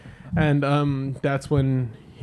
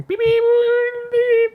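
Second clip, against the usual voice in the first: very high, loud held beeps; imitated car honk; 8 dB louder, much slower than usual. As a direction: blare it like an insistent car horn. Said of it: "you hear the honk".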